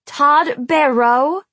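slow, high, loud incredulous question; shouting. A narrator's reading. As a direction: shout the name in disbelief. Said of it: "I shouted".

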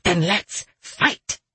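slow, relished eagerness; excitement. A narrator's reading. Voice urging soldier into battle, excited. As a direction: draw it out with excitement. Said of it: "switching to excitement".

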